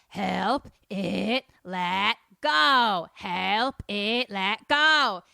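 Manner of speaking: loud, eerie, garbled recording voice; ghostly tape voice. Acted. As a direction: sound like a haunted tape message. Garbled message played on tape.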